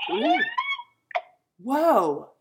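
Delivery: sudden high ooh at a spooky creak; startled by the creak; surprised. Real, not acted. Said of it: "that creak was so good".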